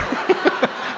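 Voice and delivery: high airy burst of laughter; real laugh. Real, not acted. Laughing at himself over the almond butter price. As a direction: laugh at yourself, caught out.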